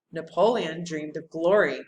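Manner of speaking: usual speaking voice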